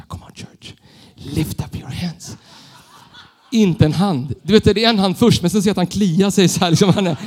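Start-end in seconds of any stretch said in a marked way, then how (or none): 0.0-2.4 whispered call, copying a hero; copied whisper. Retold or imitated. Young leader whispering to a Swedish crowd. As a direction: hush it, imitating the idol. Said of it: "Så jag pratar på engelska".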